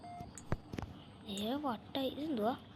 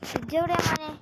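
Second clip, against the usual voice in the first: loud question; 6 semitones higher, 8 dB louder, faster than usual. Real, not acted. Gamer asking the baby about the fridge, loud and high. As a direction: call out asking where the fridge is.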